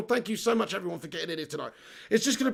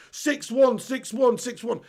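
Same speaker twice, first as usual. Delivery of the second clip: higher-pitched taunting refrain; mocking rival fans; 5 semitones higher, 4 dB louder, slower than usual. Streamer parroting gloating rival fans, mocking. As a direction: parrot the rival fans' boast. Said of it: "they're gonna use that for years".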